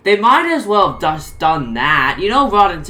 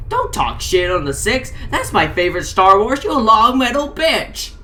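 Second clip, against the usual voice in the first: angry rant; 5 semitones higher, 3 dB louder than usual. Acted. Potato Head rants at Slinky.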